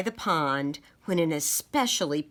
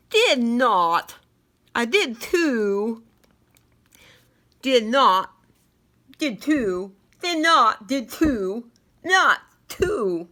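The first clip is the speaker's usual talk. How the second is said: squabbling; 4 semitones higher, 5 dB louder, slower than usual. Acted. Childish quarrel over the bug.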